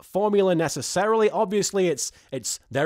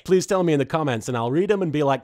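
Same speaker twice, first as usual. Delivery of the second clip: ordinary talk, nothing marked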